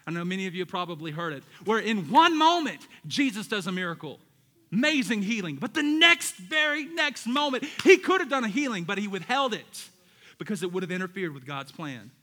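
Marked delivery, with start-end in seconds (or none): 1.6-8.8 preaching. Real, not acted. Preaching at full force.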